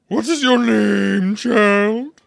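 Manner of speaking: slow, very loud, high commanding queen; imperious queen. Acted. Queen turning on Alice, slow, loud and imperious. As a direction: loom over the child and demand.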